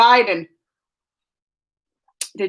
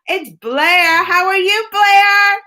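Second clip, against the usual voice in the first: high, thrilled hello, louder at the end; excited greeting; 8 semitones higher, 5 dB louder, much slower than usual. Real, not acted. Streamer spotting a familiar viewer, excited. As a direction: greet a friend with a rising squeal.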